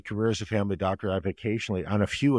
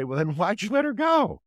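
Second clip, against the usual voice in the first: higher, sharp exasperated question; imitating a frustrated doctor challenging mom; 12 semitones higher, 4 dB louder than usual. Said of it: "you want to shake mom and say".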